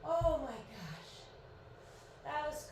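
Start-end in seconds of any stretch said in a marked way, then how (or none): 0.0-1.1 slow words stretched by a yawn. Real, not acted. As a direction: say it mid-yawn. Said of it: "Sorry I keep yawning".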